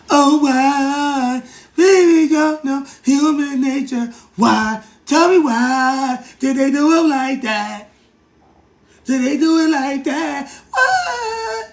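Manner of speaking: high, slow, hammed-up pleading wail; mock wailing lament. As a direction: wail the question like a grieving mourner. Pleading why they did it.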